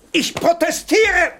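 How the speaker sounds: langsam, hoch und laut protestieren; empörter Protest. Acted. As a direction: ruft empört aus. Prinz wird die Augen verbunden. (empört und laut)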